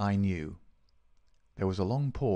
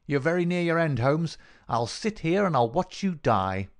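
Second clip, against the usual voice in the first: higher-pitched, triumphant villain; gloating villain; 4 semitones higher than usual. Acted. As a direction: gloat over your dying victim.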